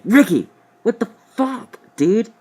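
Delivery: high, loud exasperated friend; friend's disgusted voice. Retold or imitated. David reacting to the smell, high loud outrage. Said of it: "and is like".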